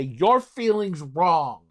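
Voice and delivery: mock a dismissive friend; slow, loud put-down. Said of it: "You don't say".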